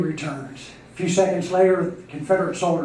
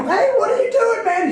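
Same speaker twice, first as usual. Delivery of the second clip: very high-pitched startled question; alarmed disbelief; 4 dB louder than usual. Acted. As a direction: challenge your friend, voice leaping up. Other bulls shocked at the third.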